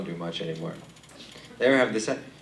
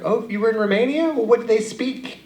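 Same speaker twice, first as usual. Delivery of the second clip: dumb friend; 12 semitones higher, 3 dB louder than usual. Retold or imitated. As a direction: play the clueless friend wide-eyed. People asking, voiced dumb.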